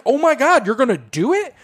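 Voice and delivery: high, incredulous question; retold disbelief. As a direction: replay a shocked bystander's reaction. Acting out own shocked reaction.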